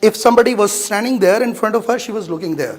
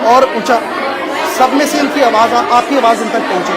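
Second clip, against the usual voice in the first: raised voice, pushing a student to project; coaching call; 3 semitones higher, much faster than usual. Real, not acted. Teacher urging a speaker on stage, raised coaching voice. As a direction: call across the room so she hears you.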